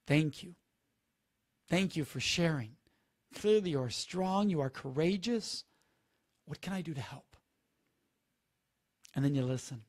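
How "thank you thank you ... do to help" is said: calm gratitude. Shown as a demonstration. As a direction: thank them gently and steadily. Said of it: "look them in the eye and say".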